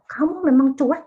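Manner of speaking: high-pitched outburst at the spouse; angry accusation. Shown as a demonstration. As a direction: burst out at the spouse who ignores you. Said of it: "karena udah marah sekali". (istri marah kepada suami yang cuek, nada tinggi)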